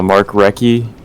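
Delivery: Recchi said with a K, "RECK-ee"; hard K pronunciation. Real, not acted. Player naming the guy on bottom left, Recchi as "RECK-ee". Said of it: "it is pronounced Recchi".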